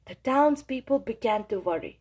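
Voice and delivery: ordinary delivery, nothing marked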